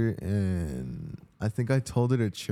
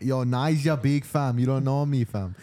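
put-on Toronto slang accent; 2 semitones higher than usual. Telling gamers to shut up, mock Toronto accent. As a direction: brush them off in a fake Toronto accent. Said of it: "speak like a Toronto guy".

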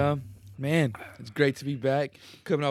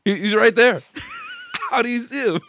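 shocked laughing; 11 semitones higher, 8 dB louder than usual. Real, not acted.

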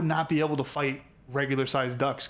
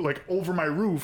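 usual speaking voice

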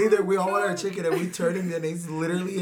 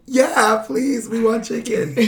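high, loud imitation of an eager group; mock excited chorus; eager pleading; 6 semitones higher, 4 dB louder than usual. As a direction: jump at the offer, begging all together. Group eagerly accepting offer, acted out.

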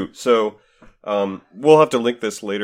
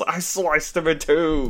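high, drawn-out imitation of a battle cry; slow retold yell at moderate volume; mock yell; 6 semitones higher, slower than usual. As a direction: stretch it out like a cartoon fighter's cry. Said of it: "he just yells".

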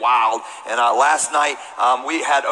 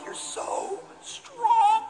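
much higher, very slow, half-crying awe; mock awe; 6 dB quieter, much slower than usual. Little boy admiring his brother through mock tears. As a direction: stretch it out, laughing and tearful.

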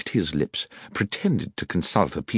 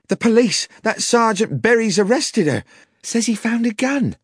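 high, loud panicked outburst; frantic young woman; 5 dB louder than usual. A narrator's reading. Young woman telling the landlord of an arrest, frantic and shrieking. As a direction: cry out the terrible news. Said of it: "came screaming into the bar".

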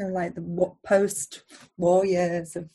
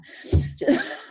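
laughing; 3 dB louder than usual. Real, not acted. Laughing at pet nightmare.

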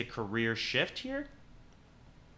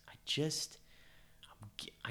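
whispering; 4 semitones higher, 6 dB quieter than usual. Real, not acted. Confides a hunch.

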